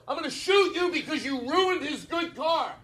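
high, slow, furious threat; mock threatening rage. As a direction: play the overreactor threatening to shoot. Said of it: "I go".

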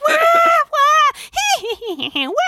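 high, loud toy wah and giggle; doll cry and giggle. Retold or imitated. Mom imitating the doll going off in the dark. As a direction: mimic the doll wailing then giggling. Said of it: "it's like".

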